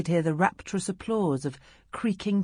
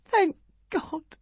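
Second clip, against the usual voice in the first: high, breathless cry of relief; mother crying thank God over her child; 13 semitones higher than usual. Acted. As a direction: gasp it out as the fear breaks. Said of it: "she cried breathlessly".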